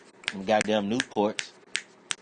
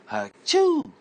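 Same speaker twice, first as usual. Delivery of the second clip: sudden high, loud mock sneeze; a big comic sneeze straight at the neighbor; loud fake sneeze; 8 dB louder than usual. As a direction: let rip a hammed-up achoo.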